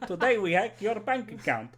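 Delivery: high, laughing mock Russian hacker; playing a Russian hacker greeting viewers; Russian accent. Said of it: "like, a Russian accent".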